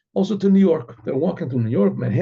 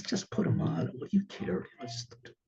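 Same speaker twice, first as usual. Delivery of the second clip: much softer private urging; hushed nudging; 12 dB quieter than usual. Retold or imitated. Wife privately to her reluctant husband, hushed urging. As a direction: nudge him along under your breath.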